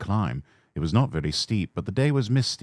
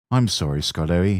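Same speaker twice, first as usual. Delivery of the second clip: usual speaking voice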